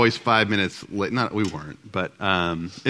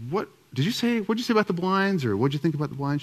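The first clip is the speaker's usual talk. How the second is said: much higher, pressing questions at his wife; anxious quizzing; 8 semitones higher than usual. Retold or imitated. Husband grilling his wife about the blinds, voice pitched up high. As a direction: pitch it up high, needling her for what she said.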